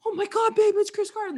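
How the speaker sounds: high, loud gushing; starstruck dads. Retold or imitated. Starstruck dads to their wives, high and excited. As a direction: imitate an excited squeal of recognition.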